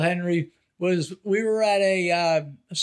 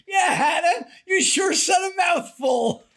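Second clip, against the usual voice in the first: laughing retort shout; 10 semitones higher than usual. Retold or imitated. Old uncle shouting a retort at a wedding bar, laughing. Henry shoots right back.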